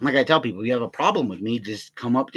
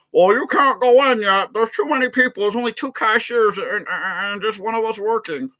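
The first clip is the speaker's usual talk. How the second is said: high, loud, dragged-out mock voice; mocking security guard; 9 semitones higher, 5 dB louder than usual. Storyteller mimics hated security guard turning people away, high mocking voice. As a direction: make the guard sound ridiculous.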